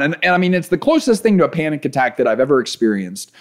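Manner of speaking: ordinary delivery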